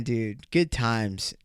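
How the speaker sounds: ordinary talk, nothing marked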